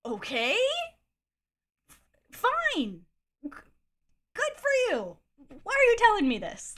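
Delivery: high, loud exasperated outburst. Retold or imitated. Reacting to his ex-girlfriend confession, exasperated. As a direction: blurt it in high-pitched disbelief.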